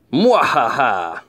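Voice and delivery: slow, hammy evil cackle; evil villain laugh. Pretend. Villain laugh after villain theme.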